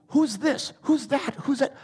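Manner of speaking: high, rapid-fire mock questioning; mock pestering questions. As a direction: imitate people fussing over who is who, pitched well up. Mimicking people fixated on who.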